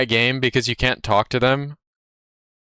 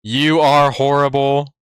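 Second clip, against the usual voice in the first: deadpan insult; 3 dB louder, slower than usual. Retold or imitated. Acting out his trash-talk message.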